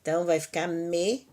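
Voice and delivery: usual speaking voice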